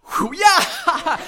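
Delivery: high, loud whoop; re-enacted gleeful cheer. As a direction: cheer like a delighted spectator. Acting out gleeful watching of attacks.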